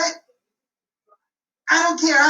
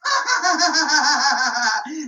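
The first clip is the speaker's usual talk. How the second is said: mischievous gloating laugh; 4 semitones higher than usual. Acted. Boy laughing at the tricked policeman, gloating laugh. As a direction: cackle at the fooled officer.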